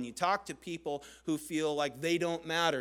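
ordinary talk, nothing marked

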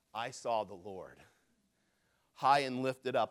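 low, soft and solemn; hushed, reverent reading. Retold or imitated. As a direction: read it quietly, in awe. Solemn reading of Isaiah's vision.

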